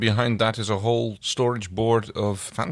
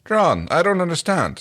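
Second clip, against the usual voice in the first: a raised, louder soldier's voice, puzzled; Aeryn voice; 10 semitones higher, 5 dB louder than usual. Acted. Fanfic reading, Aeryn puzzled at the box, raised voice. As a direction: play a confused warrior woman, pitched up. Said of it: "We're just gonna do some voices".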